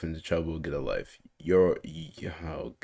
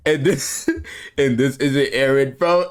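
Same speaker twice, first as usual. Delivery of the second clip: laughing out loud; 6 semitones higher, 7 dB louder, slower than usual. Real, not acted. Bursting out laughing at message.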